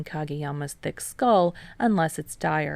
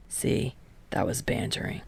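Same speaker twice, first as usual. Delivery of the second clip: low, breathy aside; hushed Kageyama; 6 semitones lower, 7 dB quieter than usual. A narrator's reading. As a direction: drop it to a near whisper. Kageyama's line, whispered.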